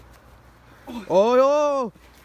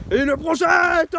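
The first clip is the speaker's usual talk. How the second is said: excited call; 5 semitones higher, 4 dB louder than usual. Acted. Wrestling play-by-play, big throw.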